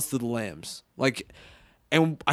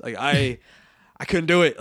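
ordinary talk, nothing marked

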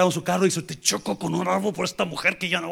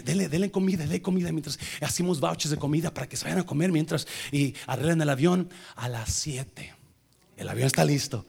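usual speaking voice

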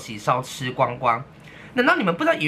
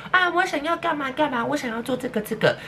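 put-on high, affected girly voice; higher sing-song voice mocking a pretentious girl; mocking a prissy girl; 10 semitones higher than usual. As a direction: camp it up like a spoiled girl asking for things. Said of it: "都嘛是孟子说".

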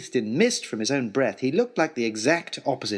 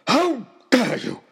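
much higher, louder, slower furious roar; full roar; 11 semitones higher, 6 dB louder, slower than usual. Acted. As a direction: let the rage peak on the repeat. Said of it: "roared Oxshott".

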